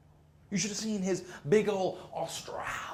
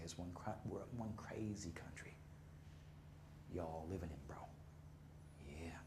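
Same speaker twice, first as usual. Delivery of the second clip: hushed closing line; 9 semitones lower, 19 dB quieter than usual. Real, not acted.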